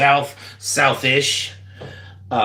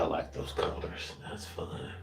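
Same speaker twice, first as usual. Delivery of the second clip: whispering; 5 semitones lower, 16 dB quieter than usual. Real, not acted. Whispered comment on painting.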